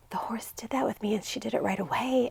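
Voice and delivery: whispered, high and soft, as if sharing a secret; hushed retelling. Retold or imitated. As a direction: breathe it out in awe. Girl retelling her horse moment.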